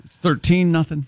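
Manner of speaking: ordinary talk, nothing marked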